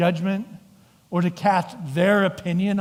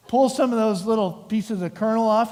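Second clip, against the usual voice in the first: ordinary delivery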